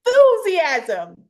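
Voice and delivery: big drawn-out hype cry; exuberant. Shown as a demonstration. Speaker revealing the E to co-host, exuberant. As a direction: announce it loud, high and slow. Hypes up the framework word.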